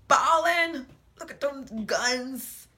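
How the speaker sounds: high, silly show-off voice; mock boasting. Vlogger joking about her workout results, mock boastful. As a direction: draw it out in a playful high brag.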